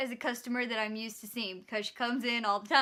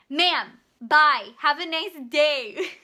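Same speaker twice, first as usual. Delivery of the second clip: much louder, slow pointed farewell; loud brush-off; 2 semitones higher, 11 dB louder, much slower than usual. Retold or imitated. Cashier ends it with loud customer, slow and pointed. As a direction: shut her down with a goodbye.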